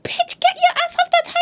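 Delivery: very high, squealed telling-off; exaggerated high-pitched indignant order; mock-outraged scolding. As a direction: shoo them off in shrill mock outrage. Scolding the character as viewer.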